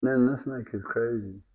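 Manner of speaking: ordinary talk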